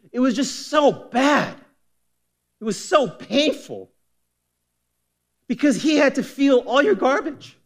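high, impassioned preaching push; preaching at full force. Real, not acted.

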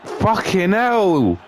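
drawn out, slow exasperated yell; real exasperation; frustrated cry. Real, not acted. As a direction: let the frustration out slowly. Frustrated outburst.

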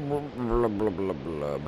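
bubbly underwater blubbing; gurgling fish man croak. Pretend. Character underwater, mimicking bubbles.

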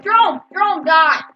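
loud urgent shout; excited shouting. Real, not acted. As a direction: yell at the enemy. Spotting enemy.

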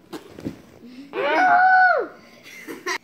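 shrieking laugh; loud laugh. Real, not acted. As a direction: burst out laughing. Child laughing loudly.

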